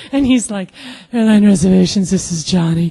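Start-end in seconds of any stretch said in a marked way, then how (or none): 1.1-2.9 smooth. Retold or imitated. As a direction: answer the phone with suave composure. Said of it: "he's so freaking smooth".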